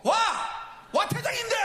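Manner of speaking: very high shouted disbelief; outraged protest. Retold or imitated. Ejected coach protesting to the umpire, outraged shout. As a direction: yell it back at the umpire. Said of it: "제가 막 그랬어요".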